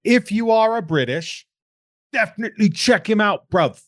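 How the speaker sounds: high, loud put-on London accent; hammed-up British bloke voice; mock British accent. Joking to British listeners in a mock London accent. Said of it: "That's my Chicago accent".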